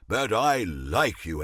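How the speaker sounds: ordinary talk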